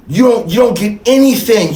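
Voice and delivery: usual speaking voice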